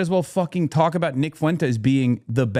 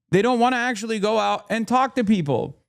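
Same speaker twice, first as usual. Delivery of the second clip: ordinary delivery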